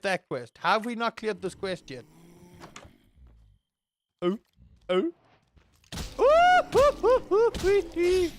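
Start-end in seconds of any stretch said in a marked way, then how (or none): 6.2-8.3 very high hoots sliding down; excited hooting. Real, not acted. Gamer hooting with glee at a win. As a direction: hoot like an excited owl.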